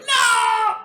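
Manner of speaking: mock horrified shout. Friend refusing a peed-on high five with a loud shriek. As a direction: recoil with a shrieked no.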